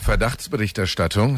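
Verdachtsberichterstattung said as a German word; German pronunciation. Shown as a demonstration. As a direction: pronounce Verdachtsberichterstattung in German, as one long compound. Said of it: "cómo se pronuncia esto".

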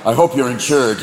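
low threatening deer voice; low, cool mock menace; menacing buck. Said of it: "jump out in front of your car like".

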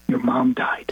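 near-whisper, retold with care; hushed bad news. Caller telling a son of his mother's death, hushed. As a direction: break it gently, almost without voice.

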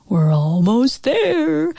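slow, high, stretched-out spooky call; spooky scary voice. A narrator's reading. Dad teasing children near the monster's pit, slow scary voice. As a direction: draw it out eerily to scare the kids. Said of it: "called out in kind of a scary voice".

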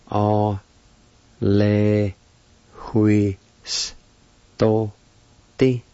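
"O, le, hui, s, to, ti." with a gap after each sound; syllable by syllable. Shown as a demonstration. Teacher pronouncing the word period, "O, le, hui, s, to, ti." syllable by syllable. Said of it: "It's pronounced like this".

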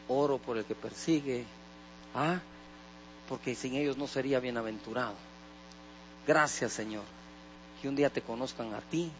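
low, soft prayerful voice; quiet prayer. Acted. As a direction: pray humbly under the breath. Pastor voices his prayer.

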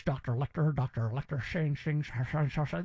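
mocking bad Southern accent, low and slurred; Jodie Foster. Host mocking a film's fake Southern accent, slurred and low. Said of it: "what Jodie Foster was doing in Silence".

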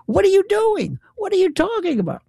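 high, exasperated questioning; exasperated disbelief. Retold or imitated. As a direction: cut in with voice shooting up in disbelief. Acting exasperated challenge to hedgers.

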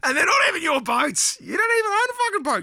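gleeful escalating rave; excited rant. Real, not acted. Building rant about boat reversing.

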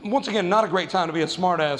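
ordinary talk

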